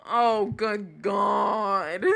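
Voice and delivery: very slow, drawn-out groan; appalled groan. Real, not acted. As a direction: drag it out in despair. Appalled at chat messages.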